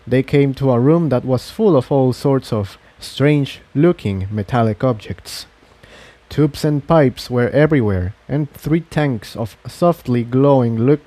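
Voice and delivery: usual speaking voice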